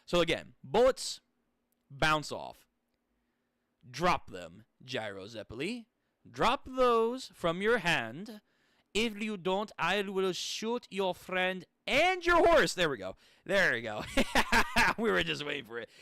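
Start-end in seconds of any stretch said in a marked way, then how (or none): 3.9-12.7 slow, deliberate threat in a put-on German accent; menacing German gunman. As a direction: order your enemy to surrender, building to the end.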